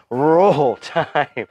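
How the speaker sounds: normal talking voice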